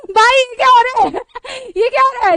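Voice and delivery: high, loud, half-laughing cry; mock outrage breaking into laughter; laughing disbelief. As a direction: laugh through the words in disbelief. Laughing at being misnamed.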